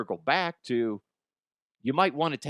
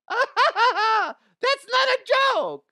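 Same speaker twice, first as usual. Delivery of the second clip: high, loud shriek through laughter; laughing squeal; 6 dB louder, much slower than usual. Real, not acted. Host cracking up at his own joke, shrieking with laughter.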